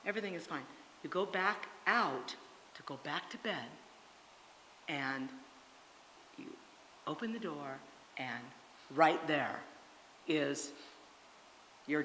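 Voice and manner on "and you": usual speaking voice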